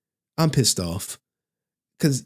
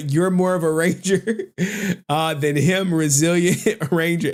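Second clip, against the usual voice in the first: laughing through a mock compliment; higher, giggling mock praise; 5 semitones higher than usual. Teasing the Power Ranger actor, laughing.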